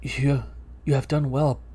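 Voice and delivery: low, soft spooky whisper-voice. Acted. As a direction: sound like a ghostly presence. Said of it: "there's spooky voice".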